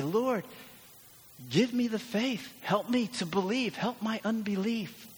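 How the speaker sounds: high, earnest acted plea; lifted voice, praying without shouting; pleading prayer. Worried worker asking the Lord for faith, high-pitched pleading. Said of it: "but say".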